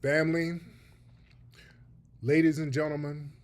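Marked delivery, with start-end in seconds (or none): none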